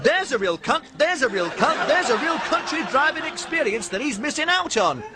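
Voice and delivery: high, loud stuck-record stutter; Stevie's stammer turning rude. Acted. Imagined bystanders, laughing shout.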